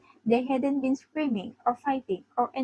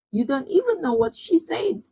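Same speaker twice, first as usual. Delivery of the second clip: higher-pitched indignant protest; mild yell; 6 semitones higher than usual. A narrator's reading. Zoro objecting to Sanji, mild high yell. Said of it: "Zoro yelled".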